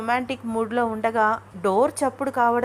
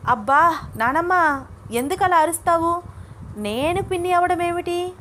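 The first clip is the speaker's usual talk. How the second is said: high-pitched, puzzled protest; baffled questioning of grandmother; bewildered; 7 semitones higher than usual. Acted. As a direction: ask in utter confusion, voice lifted. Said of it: "అయోమయంగా అంది అనన్య".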